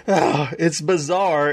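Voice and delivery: normal talking voice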